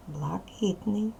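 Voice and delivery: soft whispered hint. Acted. Teacher whispering the answer to pupils. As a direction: whisper the answer to help the class along.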